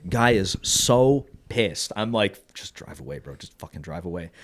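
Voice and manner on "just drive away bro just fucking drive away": low, hushed plea under the breath; quiet pleading. Retold or imitated. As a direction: mutter it privately, much softer and lower. Muttered plea to angry driver.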